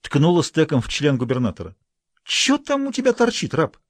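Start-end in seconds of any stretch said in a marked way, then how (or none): 2.2-3.8 high mocking schoolgirl voice; girl's voice. A narrator's reading. Pioneer girl mocking the governor, high and taunting. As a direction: taunt him in a high girlish voice.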